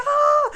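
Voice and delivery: high, loud cry of alarm; panicked scream. Retold or imitated. Frantic mother screaming as she spots her child. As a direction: let out a sharp scream. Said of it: "I'm like".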